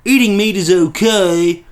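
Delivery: loud, high, slow dumb-person voice; hammed-up dim-witted voice, slow and loud; Bearing. Mocking a rival YouTuber with an impression, slow dumb voice. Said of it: "this is my Bearing impression".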